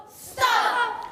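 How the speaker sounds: high yell of Stop!. Acted. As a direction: cry it out like Stan. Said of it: "What did he yell?".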